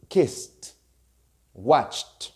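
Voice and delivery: "kissed", "watched" each ending in a crisp T; one syllable each for "kissed" and "watched", T at the end. Shown as a demonstration. Teacher gives examples of past endings, ED as T. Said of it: "will sound like a T".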